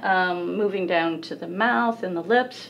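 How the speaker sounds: ordinary talk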